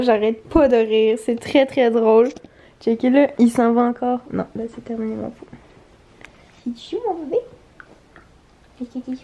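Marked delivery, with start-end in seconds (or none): 4.3-9.2 much softer, hushed pet talk; soft soothing. Real, not acted. Owner softly calming her tipsy dog, quiet pet talk. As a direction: hush it gently to the dog.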